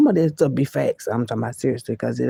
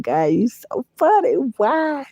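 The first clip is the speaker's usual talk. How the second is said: mock exasperation; 9 semitones higher than usual. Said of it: "I be like".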